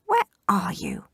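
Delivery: hushed searching whisper. Acted. Wolf whispers.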